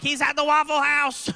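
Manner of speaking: high, put-on tipster voice; mock prank caller. Prank tipster phoning police with a high fake voice. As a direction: squeak out a fake tip. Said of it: "I'm like".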